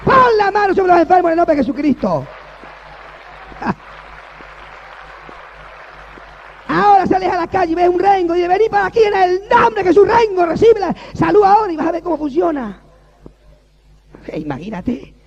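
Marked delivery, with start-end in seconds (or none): 0.1-2.2 very loud, high, slow preacher's shout; shouted preaching. Acted. Pastor shouting faith to the congregation, very loud and high. 2.3-6.6 laugh. Real, not acted. Laugh amid shouting. 6.7-12.8 very loud, high, slow preacher's shout; shouted preaching. Acted. Pastor shouting faith to the congregation, very loud and high.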